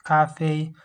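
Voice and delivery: "cafe" slow, both syllables high and level; high-pitched tones. Shown as a demonstration. Teacher summing up the tones, "cafe" high and level. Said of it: "they are all high-pitched tones".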